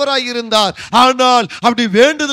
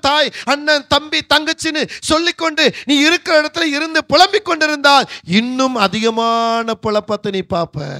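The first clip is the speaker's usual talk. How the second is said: ordinary delivery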